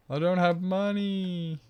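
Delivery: high, slow pitiful whine; hammed-up poor me; mock whine. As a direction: plead poverty like a sad child. Pitiful plea about money.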